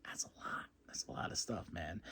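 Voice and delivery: a breathless, unvoiced whisper of awe; overwhelmed hush; awed whisper. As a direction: whisper it like it's almost too much. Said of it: "I was like".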